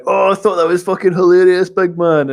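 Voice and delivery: hammed-up gleeful approval; mock cheering. Friend retells how passers-by cheered, gleeful mock voice. Mates mimic cheering supporters.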